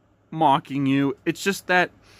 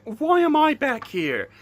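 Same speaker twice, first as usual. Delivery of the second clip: usual speaking voice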